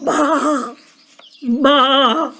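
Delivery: two imitated baas; sheep bleats. Shown as a demonstration. Storyteller asks who makes the sound, sheep bleats. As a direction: bleat for the children to guess. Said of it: "who makes the sound".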